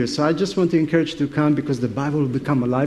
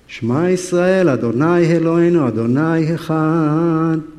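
serious Hebrew prayer; 4 dB louder than usual. Retold or imitated. Peter insisting on his prayer, louder earnest Hebrew chant. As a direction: pray the Shema hard to shut out the voice. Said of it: "This is serious business here".

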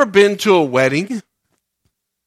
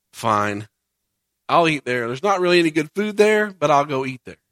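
grudging; 6 semitones lower, 5 dB quieter than usual. Retold or imitated. Grudging reply to spouse.

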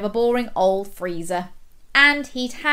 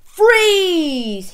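loud, high heroic command; long, commanding yell; shout; 8 semitones higher, 7 dB louder than usual. A narrator's reading. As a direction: shout it like a superhero halting a villain. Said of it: "he shouted".

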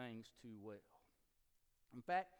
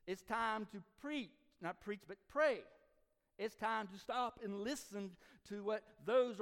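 loud, higher, rising sermon climax; preaching at full force; 6 semitones higher, 5 dB louder than usual. Acted. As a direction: drive the message home to the congregation.

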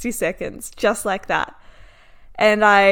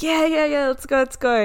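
eager urging; 6 semitones higher than usual. Retold or imitated. Driver urging her on.

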